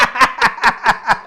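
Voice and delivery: laughs out, high and loud. Real, not acted. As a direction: burst of laughter at the punchline. Laughs at the joke.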